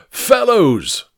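put-on high-pitched drunken hail; acted shout of greeting; over-loud shouting. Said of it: "shouting louder than necessary".

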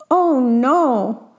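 drawn-out storybook "oh no"; dismayed shout. A narrator's reading. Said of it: "shouted Antonia".

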